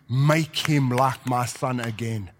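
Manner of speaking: longing quietly for his son back; gentle, drawn-out father's wish; tender plea. Retold or imitated. Father's tender wish, softly slowed.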